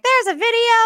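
high teasing squeal; a mock squeal for the reveal; squealy quote. [name] teasing the audience, in a high squeal.

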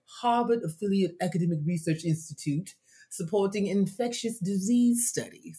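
reading in a put-on posh Harvard accent; lightly voiced Harvard accent, playing it up. Reading a listener's job title in a mock Harvard accent. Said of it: "That's my Harvard accent".

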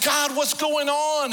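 very high, loud, slow plea to God; anguished cry. Retold or imitated. Preacher crying out to God, high and loud. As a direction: cry out in bewilderment.

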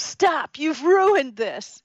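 high, loud, slow exasperation; exasperated. Retold or imitated. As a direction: drag out the frustration. Frustrated texter.

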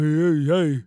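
mock tough-guy boxer greeting; kid acting like Rocky. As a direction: imitate a schoolkid doing Rocky. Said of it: "the kid who thought he was Rocky".

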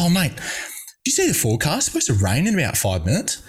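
high, quick fake-worried warning; mock concern. Athlete tricking a chatty rival about rain in Doha, mock-concerned and high. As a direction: sell the fake rain forecast earnestly.

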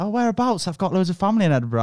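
high, quick, delighted Scottish teacher voice. Retold or imitated. Scottish teacher questioning a pupil about Edinburgh, keen Scottish lilt. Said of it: "it was a Scottish teacher".

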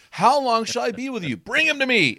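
high, loud, fed-up cry; Jesus losing patience with them; exasperated exclaim. Shown as a demonstration. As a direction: call it out in exasperation. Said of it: "That's how I think he said it".

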